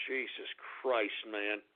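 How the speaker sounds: exhausted grumble; weary mutter. Acted. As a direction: sigh it out, defeated. Exasperated aside.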